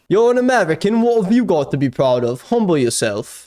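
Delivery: a hint of a snooty Brit; mock British tweet. The streamer reading his own tweet as a smug Brit. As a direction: read it like a smug Brit, lightly. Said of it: "him being British".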